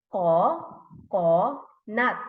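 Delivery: "Ko, ko, nut" as three separate beats; syllable by syllable. Shown as a demonstration. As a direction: pause after each syllable of "Ko, ko, nut". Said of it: "Three syllables".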